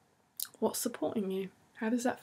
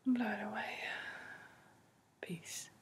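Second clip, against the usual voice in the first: much softer whispered release; 9 dB quieter than usual. Acted. Guide whispers listeners through the exhale. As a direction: whisper peace on the out breath.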